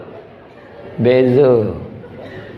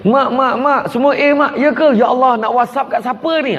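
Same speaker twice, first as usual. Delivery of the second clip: much higher, eager, gushing; excited girl and mum; 10 semitones higher than usual. Retold or imitated. Daughter tells mum her results and mum gushes, high and excited. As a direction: gush the news in a bright high rush. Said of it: "Tu mak".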